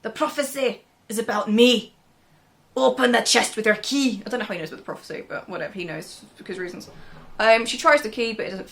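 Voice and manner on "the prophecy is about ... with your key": put-on a second try at a Scottish accent, slightly louder; a lightly put-on Scottish king's voice. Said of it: "I can't do a Scottish accent".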